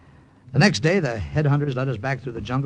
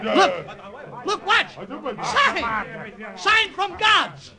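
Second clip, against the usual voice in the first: much higher, drawn-out cry of a sign; mock awed shout; 12 semitones higher, much slower than usual. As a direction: sell the fake miracle with big awe. Faking omen to scare natives.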